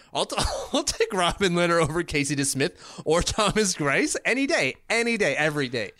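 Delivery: a cheerful, hammed-up boast; mock confident boast. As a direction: play the cocky fan who'd pick him any day. Imagined confident goalie pick.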